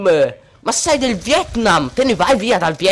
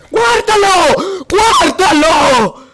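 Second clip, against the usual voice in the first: higher, louder, slow stretched-out yell; angry yell; 7 semitones higher, 6 dB louder, slower than usual. Real, not acted. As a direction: shout it at the screen in disgust. Gamer yelling at enemy. (urlo furioso e strascicato)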